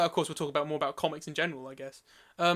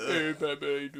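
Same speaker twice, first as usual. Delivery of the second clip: lower, put-on movie tough-guy voice; Sylvester Stallone; 4 semitones lower, 4 dB quieter, much slower than usual. Host calling to Batman in a mock Stallone voice. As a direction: mumble it like an action star. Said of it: "That's what you sounded like just then".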